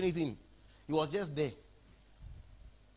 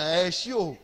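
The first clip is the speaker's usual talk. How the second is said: rising call, getting louder; playful angel's call growing louder; mock angel calling out; 4 semitones higher, 5 dB louder, much slower than usual. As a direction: call out to her like a teasing angel. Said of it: "The angel is telling her".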